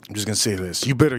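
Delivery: ordinary talk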